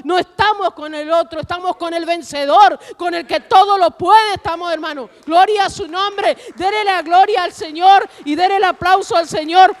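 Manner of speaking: rousing preacher building to loud exhortation; fervent preaching. Acted. Preacher urging the congregation to give glory to the Lord, fervent preaching. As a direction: drive it up to a high, loud call for praise.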